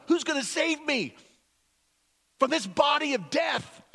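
high, loud sermon building to a shout; impassioned preaching. Acted. Paul confessing his inner struggle, preached at full force. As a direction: build Paul's plea to a cry.

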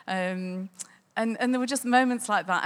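ordinary delivery

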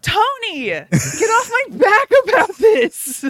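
high, drawn-out whiny protest; mock exasperation. Real, not acted. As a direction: whine loudly at a nagging friend, stretching it out. Mock squabble over being hurried.

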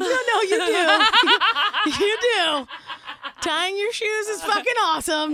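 loud, high, laughing insistence; emphatic protest. Retold or imitated. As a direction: burst out in mock-urgent reassurance. Urgently reassuring a child, retold.

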